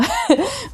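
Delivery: real little laugh at oneself; self-deprecating laugh. Real, not acted. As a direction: laugh briefly at your own clumsiness. Laughs at her own poor explaining.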